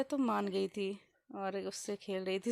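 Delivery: usual speaking voice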